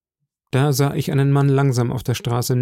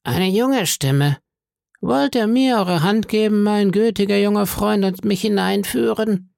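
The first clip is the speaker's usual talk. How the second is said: eerie sing-song beggar; 10 semitones higher than usual. A narrator's reading. Blind beggar begging the innkeeper's boy, high sing-song voice. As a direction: lilt each phrase upward like a pitiful plea. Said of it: "sagte der Blinde".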